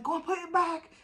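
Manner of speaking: high, loud mock shout at her son. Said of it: "Start shouting".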